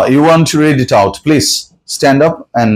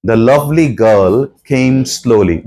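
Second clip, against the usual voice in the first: whole sentence very slow, stress on lovely; slow dictation; 2 semitones lower, much slower than usual. Shown as a demonstration. Teacher reading the full sentence to the class, slow and even. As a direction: read it out in one slow run. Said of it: "আচ্ছা, এটা বলো তো দেখি".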